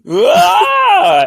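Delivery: high, loud pained goblin scream. Acted. Goblin losing his stilt mid-fight, high pained scream. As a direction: shriek as the stilt is yanked away. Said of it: "He's like".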